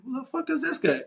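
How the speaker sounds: high-pitched, incredulous retold question; baffled disbelief. Host retelling his reaction to the stranger leaving, baffled. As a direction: pitch jumps up in mock bafflement.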